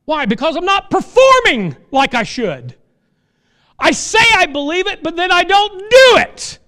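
high, loud, impassioned preaching; full-force preaching. Acted. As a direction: preach it out with fire.

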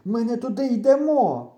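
high, loud alarmed protest; nearly breathless. A narrator's reading. Boy stopping his friend on the wrong path, nearly breathless. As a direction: protest while short of breath. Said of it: "майже задихаючись".

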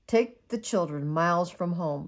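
gentle storybook read-aloud; cosy bedtime story narration; reading to children. Acted. Mother reads bedtime story.

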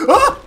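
short, high, loud yelp; sudden shriek of fright; startled shriek. Real, not acted. Streamer startled by the game, shrieking.

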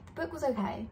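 ordinary delivery

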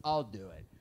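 low, soft grudging mutter; mock a pissy worker grudgingly giving in. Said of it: "This guy gets all pissy".

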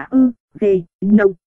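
"ơ, ghê, nông" as three separate syllables; syllable-by-syllable reading. Shown as a demonstration. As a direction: sound out "ơ, ghê, nông" with pauses. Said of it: "eugenon đọc là". (đọc "ơ, ghê, nông" tách từng âm tiết)

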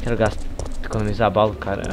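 usual speaking voice

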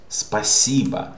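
teacher's clear Спасибо, stressed in the middle; stress on middle syllable. Shown as a demonstration. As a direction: lean on the second syllable of Спасибо. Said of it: "This is stressed".

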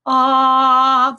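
"ah" held high and loud, mouth open; saying ahh. Shown as a demonstration. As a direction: open "ah" sung high on the mid note. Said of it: "that's doing the ah sound".

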